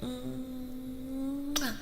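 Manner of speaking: drawn-out high "mwah"; blown kiss. Real, not acted. Streamer sends wifey a kiss, drawn-out mwah. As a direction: send a long smooch.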